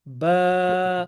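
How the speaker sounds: "ba" with its vowel held long. Shown as a demonstration. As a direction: stretch "ba" out into baah. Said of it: "we read it like ba".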